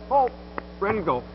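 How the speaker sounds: ordinary talk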